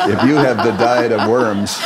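chuckling while delivering the punchline; laughing through a joke. Real, not acted. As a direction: laugh the words out, amused at your own joke. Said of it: "which we often jokingly say".

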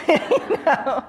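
usual speaking voice

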